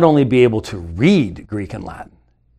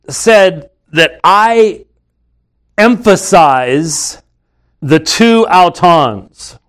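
slow deliberate; 2 semitones higher, much slower than usual. Real, not acted. Slow deliberate build to key term.

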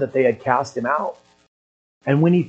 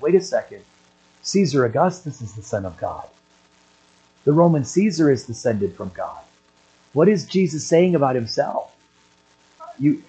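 normal talking voice

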